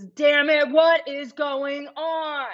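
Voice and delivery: high, drawn-out imitated fury; retold angry outburst. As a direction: act out losing patience, stretching each word. Acting out man's repeated angry demand.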